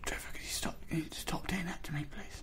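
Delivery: whispering. Acted. Whispered complaint in bed.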